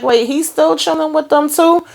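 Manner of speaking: high-pitched disbelieving question; mock surprise. Acts out own surprised reaction.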